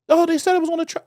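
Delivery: high put-on voice; mock pleading. The man insisting his stuff was on the truck, high mock whine. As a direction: ham up his whiny excuse.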